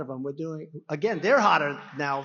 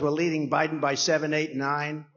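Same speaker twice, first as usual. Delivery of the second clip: ordinary talk, nothing marked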